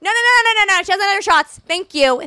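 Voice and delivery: high yelled warning; mock yelling mom. Daughter imitating mom yelling that the puppy has no shots. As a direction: shout down the block to strangers. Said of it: "she'll just scream".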